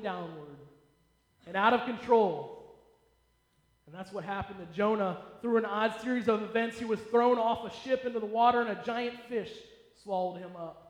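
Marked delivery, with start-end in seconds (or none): none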